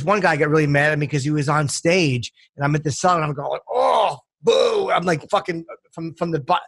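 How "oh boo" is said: high, drawn boo from the back; mock booing, pitched up; retold heckle. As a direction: imitate a heckler booing the stage.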